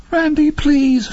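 high, slow trembling plea; scared pleading. A narrator's reading. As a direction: beg him, terrified. Terrified plea to Randy.

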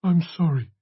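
high, gentle character voice; gentle priest. A narrator's reading. Priest apologises to Brennan over a bequest, gentle. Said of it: "he said in his gentle voice".

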